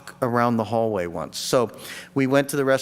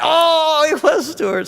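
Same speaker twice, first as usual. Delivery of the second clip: high, loud burst of discovery; light up as it clicks; sudden realization; 13 semitones higher, 5 dB louder, slower than usual. Retold or imitated. Finding the Post-it note, high surprised realization. Discovering who sent the food.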